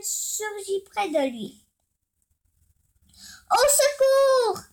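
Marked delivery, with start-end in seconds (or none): 3.5-4.6 high, loud, slow panicked call; cry for help. Acted. Lou calling for help at the whale shark, loud frightened cry. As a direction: shout for rescue as the shark appears. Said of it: "cria Lou".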